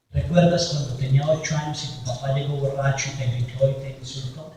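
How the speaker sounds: the Lord speaking in the mind, softly; soft inner voice. Pretend. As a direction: murmur it as a memory rising.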